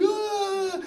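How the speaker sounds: drawn-out put-on bawl; mock child's wail. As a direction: imitate a little kid bursting into tears. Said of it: "she cried all the time".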